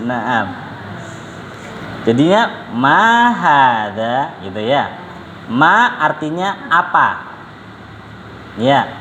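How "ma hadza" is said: MA stretched and arched, HADZA drawn out to a rise; very slow question tune on "Ma hadza"; question intonation. Shown as a demonstration. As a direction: model "Ma hadza" as a long, lilting question. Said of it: "Jadi intonasinya seperti itu".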